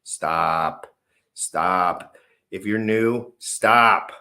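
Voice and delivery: loud, drawn-out command; emphatic warning. Real, not acted. Warning new buyers not to buy, loud emphatic order. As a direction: hit every stop hard and leave space.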